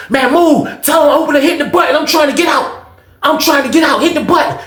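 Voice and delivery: high, urgent protest; arguing. Retold or imitated. Skate trying to leave the cell mid-quarrel, arguing urgently. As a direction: imitate someone insisting on being let out. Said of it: "So they in that joint arguing".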